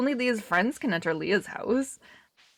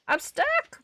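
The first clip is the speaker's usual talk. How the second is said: much higher, alarmed cry; stuck; 3 dB louder than usual. Real, not acted. Caught in the tall grass, a high alarmed yelp.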